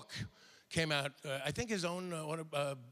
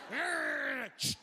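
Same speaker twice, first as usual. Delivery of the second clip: pained yelling; 13 semitones higher than usual. Pretend. Bitten dog trainer screaming at the crew to cut. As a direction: yell like your finger just got bitten. Imagined outtake, dog bite.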